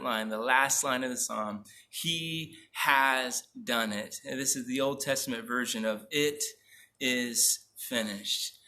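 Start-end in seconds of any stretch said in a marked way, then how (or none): 1.9-4.2 deliberate, emphatic scripture reading at half pace; slow, weighty declaration. Acted. Preacher reads the psalm's favorite last line, slow and weighty. 6.1-8.6 each word set apart, drawn out; slow, solemn declaration. Acted. Preacher echoing the psalm's last line, slow and solemn.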